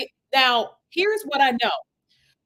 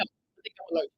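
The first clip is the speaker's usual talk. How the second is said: whispered aside; 10 dB quieter, faster than usual. Real, not acted. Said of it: "unvoiced reply".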